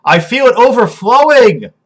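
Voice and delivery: high, loud, melodramatic ecstasy; grand, overflowing declaration of love; rapturous outpouring. Acted. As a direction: pour it out in swooning rapture.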